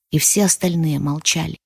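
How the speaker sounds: ordinary delivery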